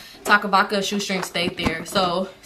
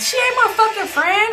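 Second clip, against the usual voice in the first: high, loud, indignant outburst; indignant retort; 13 semitones higher, 4 dB louder than usual. Retold or imitated. Driver disowning her friend's friend, high indignant retort. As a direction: re-enact an outraged refusal, pitched way up. Acted quarrel, refusing to claim her.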